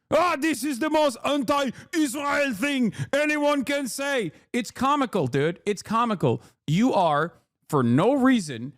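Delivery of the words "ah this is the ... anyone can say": high, accented hammed-up indignation; mock outrage. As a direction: parody someone scandalized, in a put-on accent. Mimics an offended critic of Rachel.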